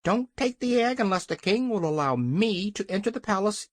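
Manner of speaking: high-pitched hen voice; insistent hen. A narrator's reading. Hen bargains to enter the palace, insistent. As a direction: urge it in a high voice. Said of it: "said Billina".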